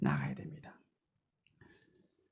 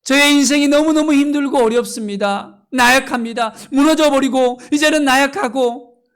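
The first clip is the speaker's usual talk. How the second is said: much higher and louder fervent preaching climax; rising pulpit plea, higher and louder; impassioned preaching; 8 semitones higher, 9 dB louder than usual. Real, not acted. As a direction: cry it out to the congregation at full force.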